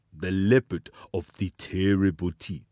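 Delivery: plain reading voice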